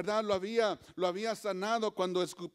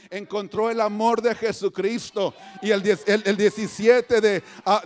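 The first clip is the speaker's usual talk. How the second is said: loud, driving preacher's cadence; preach; 12 dB louder than usual. Real, not acted. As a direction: proclaim it at full force. (predicación a plena voz)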